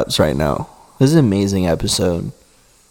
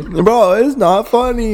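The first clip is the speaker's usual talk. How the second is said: high squeaky protest; 12 semitones higher, slower than usual. Pretend. High-pitched mock protest.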